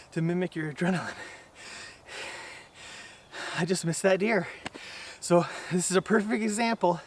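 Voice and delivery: winded, broken into short phrases with breaths. Real, not acted. Archer explaining a missed shot after running, out of breath. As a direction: explain it while recovering your breath. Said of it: "I'm totally out of breath".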